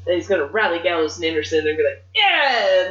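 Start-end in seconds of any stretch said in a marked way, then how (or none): 2.2-2.8 high, loud mock cheer; mock rallying cheer. Wrestlers rallied against their former partner, mock cheering. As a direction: whoop like a riled-up gang.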